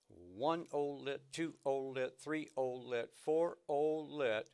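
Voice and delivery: slow, drawn-out "olet" counting. Shown as a demonstration. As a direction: count each beat slowly so the students follow. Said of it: "I'll play it really slow so you can hear it".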